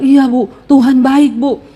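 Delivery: slow, measured meek reply; lightly voiced penitent, unhurried; humble ex-convict. Reformed man insists God is good, acted slow humility.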